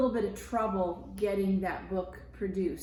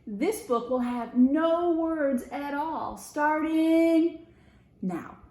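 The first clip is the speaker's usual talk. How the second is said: high, loud proclaiming mouse; grand announcement; 5 semitones higher, 6 dB louder, slower than usual. Acted. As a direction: announce it grandly, pause before now. Rupert announcing wordless book.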